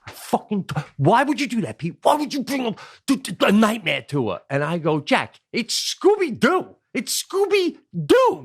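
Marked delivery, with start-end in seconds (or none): 0.1-4.4 high-pitched mock scolding; imitating angry wife. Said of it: "Jackie turns to me and goes". 5.0-8.4 high, slow incredulous stress on Scooby-Doo; retold exasperated protest. Retold protest to his wife.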